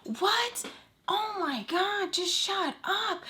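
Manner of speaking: high, slow, drawn-out outburst; acted impatient scolding; exasperated mock yell. Acted angry interruption of her stutter.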